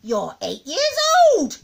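loud, high, slow granny scorn; loud derisive old-woman retort; scornful snort. A narrator's reading. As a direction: snort it at a child with contempt. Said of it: "she snorted".

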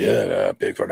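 deep, mocking hunter voice; mocking big guy. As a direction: drop low and sneer at him like the big hunter. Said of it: "laughing at me".

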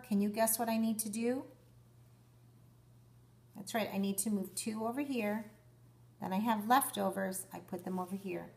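ordinary delivery

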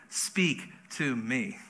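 very slow, soft repeat of the prayer; quiet, drawn-out plea; solemn hushed prayer. Acted. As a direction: let each word land gently. Repeats the key prayer slowly.

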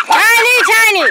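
high, loud call. Real, not acted. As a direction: call her off. Scolding barking dog.

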